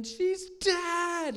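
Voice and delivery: high-pitched cry imitating screaming mourners; drawn-out mock scream; imitated scream. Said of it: "and they're screaming".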